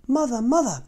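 put-on high, loud calling of young swans; excited calling. A narrator's reading. Young swans call their mother, high and excited. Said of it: "his new friends cried".

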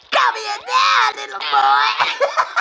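high, slow call dissolving into laughter; laughing. Real, not acted. Streamer calling the game pet while laughing. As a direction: try to scold but keep cracking up.

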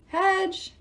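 high call for help; calling for Hedge to come help; yell. A narrator's reading. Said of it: "Jason yelled".

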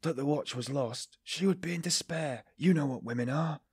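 deliberate tremble. A narrator's reading. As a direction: plead with a carefully staged tremor. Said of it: "carefully making his voice tremble".

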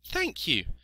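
high-pitched piglet voice; little piglet, thankful. A narrator's reading. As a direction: say thanks as a small pig.

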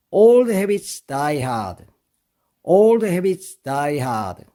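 very slow, each word laid out for learners; slow model reading. Shown as a demonstration. Teacher to students, very slow model reading. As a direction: read it out slowly for students to copy. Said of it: "이렇게 하면 되는데요".

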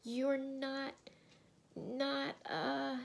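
high, halting, fearful question; shaky voice. A narrator's reading. Susan fears Aslan is a ghost, shaky high voice. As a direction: falter high between the words. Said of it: "in a shaky voice".

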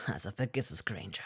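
low, whispered; whispered dismissal. A narrator's reading. Malfoy quietly dismissing Hermine.

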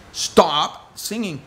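"Stop singing" very slowly and drawn out, every syllable stretched; drawn-out plea. Retold or imitated. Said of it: "And I was like".

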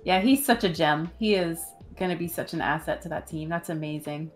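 usual speaking voice

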